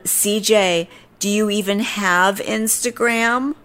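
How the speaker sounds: high, drawn-out condescending question; snarky woman imitation, rising at the end; mock snarky. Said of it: "snarky back with me".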